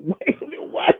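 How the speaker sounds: high, rising double-take; startled. Retold or imitated. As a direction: blurt it in retold disbelief. Retelling startled reaction to heavy French.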